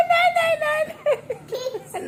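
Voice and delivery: high shrieking pretend panic, half laughing; mock terrified cry. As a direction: play-act being scared of the bat, loud and high. Mother pretends fear of bat.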